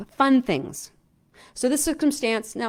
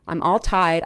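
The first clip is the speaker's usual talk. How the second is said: normal talking voice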